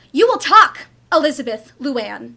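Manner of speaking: loud, firm full-name warning; angry mother's final warning; stern ultimatum. Acted. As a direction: lay down the law.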